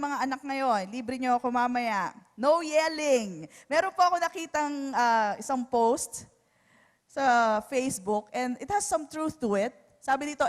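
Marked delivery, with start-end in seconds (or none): none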